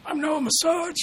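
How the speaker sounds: very high, loud puppet voice; Noah puppet voice. Pretend. Mocking co-host Noah, high puppet voice. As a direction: squeak it out as a puppet.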